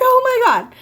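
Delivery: high, loud oh my God; excited gasp. Retold or imitated. Retelling the cousin's reaction, squealing oh my God.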